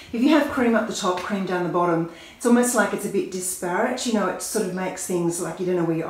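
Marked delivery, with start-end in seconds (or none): none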